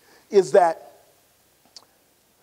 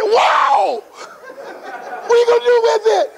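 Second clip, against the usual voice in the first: teasing laughing teammate; 10 semitones higher than usual. Retold or imitated. Barry teasing about the check.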